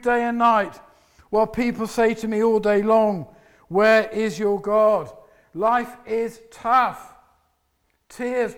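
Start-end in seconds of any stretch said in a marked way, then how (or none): none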